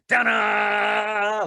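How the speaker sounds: high, loud ta-da like a drum roll payoff; fanfare ta-da. Pretend. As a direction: belt it out like announcing a magic trick. Revealing Tom Cruise's surprise entrance.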